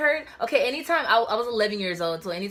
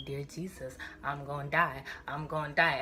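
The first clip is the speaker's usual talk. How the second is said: quiet; 5 semitones lower, 7 dB quieter than usual. Retold or imitated. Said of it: "in my head, I'm like".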